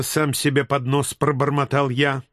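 plain reading voice

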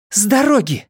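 high, urgent command; demanding. A narrator's reading. Inej orders the boys aside to run, demanding. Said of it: "потребовала она".

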